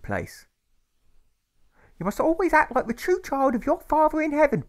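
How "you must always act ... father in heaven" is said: very high, loud, tearful mother's voice; a grieving mother simpering to her son; whimpering mother. A narrator's reading. Mother whimpers to her little son, high and tearful. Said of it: "she whimpers and simpers".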